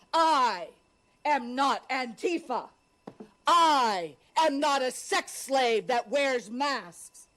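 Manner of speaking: ordinary talk, nothing marked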